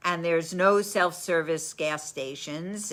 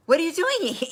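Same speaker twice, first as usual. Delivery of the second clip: startled question; 12 semitones higher, 6 dB louder, faster than usual. Retold or imitated. Girl surprised by strangers.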